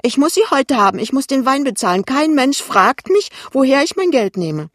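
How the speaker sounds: high, loud mother, aggrieved; demanding mother. Acted. Mother demands her rent money.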